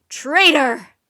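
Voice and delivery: very loud furious shriek. Acted. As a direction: spit the word at the betrayer. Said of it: "the Raven King shrieked at Rock".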